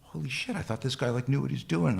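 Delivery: high, hushed voice of a doubting student; small, high-pitched mock astonishment; mock student disbelief. Voicing the doubting students' reaction.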